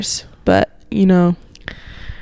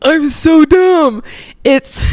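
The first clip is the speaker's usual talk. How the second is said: slap-your-forehead delight; excited realization; 6 semitones higher, much slower than usual. Real, not acted. She finally realizes the answer, excited at herself.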